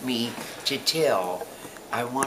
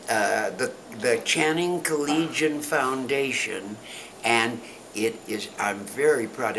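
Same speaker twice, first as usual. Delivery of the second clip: normal talking voice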